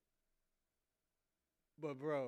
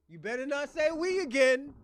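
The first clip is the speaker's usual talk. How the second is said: louder warning; 5 semitones higher, 7 dB louder than usual. Real, not acted. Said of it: "repeated warning".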